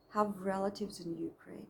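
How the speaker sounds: ordinary talk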